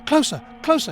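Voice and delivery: high, coaxing frog voice. A narrator's reading. Frog urging the princess closer. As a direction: wheedle her like the frog. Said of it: "he said".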